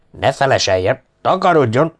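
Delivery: furious officer's order to leave; angry dismissal. A narrator's reading. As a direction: throw him out angrily. Major angrily dismisses the sergeant.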